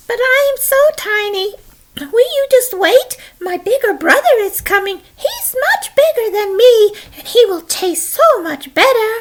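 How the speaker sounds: very high, pleading little voice; tiny baby goat. Acted. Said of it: "little itty-bitty baby billy goat".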